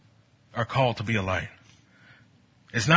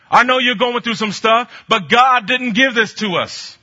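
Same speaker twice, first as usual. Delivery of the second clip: higher, louder, fervent sermon; full-force preaching; 9 semitones higher, 8 dB louder than usual. Acted. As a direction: preach it with fire.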